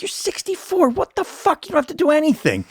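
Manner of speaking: high-pitched, fast, incredulous rant at himself; incredulous self-scolding. Retold or imitated. Sixty-four-year-old telling himself to quit, high and incredulous. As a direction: talk yourself out of it in a squeaky disbelieving voice.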